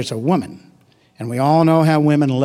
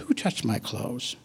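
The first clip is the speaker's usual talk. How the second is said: hushed question; 2 semitones lower, 11 dB quieter, slower than usual. Acted. Quiet quoted question.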